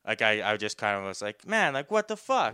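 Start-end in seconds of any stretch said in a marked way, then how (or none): 1.5-2.5 exasperated protest. Retold or imitated. Protesting the hit.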